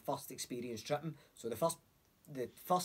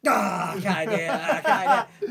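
loud, higher breathless gasp with a laugh; 5 semitones higher, 13 dB louder than usual. Retold or imitated. Giving up holding his breath, gasping loud. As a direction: burst out of a held breath.

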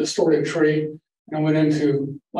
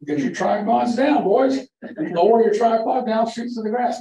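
teacher's orders; 6 semitones higher than usual. Retold or imitated. Teacher directing photo group.

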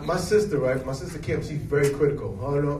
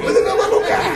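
put-on high-pitched, loud cooing over a cute baby, laughing; fans cooing at a baby; 8 dB louder, faster than usual. Fans gushing over Baby Pete, high cooing. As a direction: coo like a fan melting over a baby.

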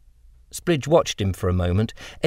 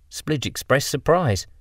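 plain reading voice

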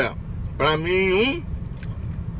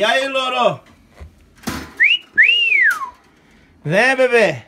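calling the parrot; 9 semitones higher, 5 dB louder than usual. Real, not acted.

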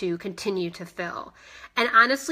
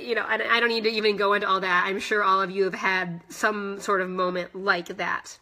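usual speaking voice

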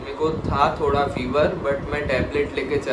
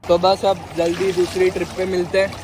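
higher, louder cheerful sign-off; upbeat vlog outro; 7 semitones higher, 4 dB louder, slower than usual. Acted. Vlogger signing off to viewers, upbeat and loud. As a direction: sell the goodbye with energy.

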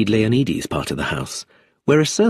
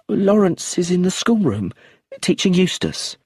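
suspicious widow; 9 semitones higher than usual. Acted. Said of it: "stared suspiciously".

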